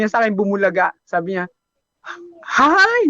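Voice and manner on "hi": put-on high, chirpy "Hi!" in a girl's voice; bright girlish greeting. Customer greeting her barber in a high girlish hello. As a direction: pop up with a cheery girl's hello.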